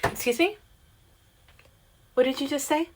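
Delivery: high, affronted voice; indignant challenge. Retold or imitated. To the customer who called her a bitch, high indignant challenge. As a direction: dare her to repeat it. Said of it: "and I went".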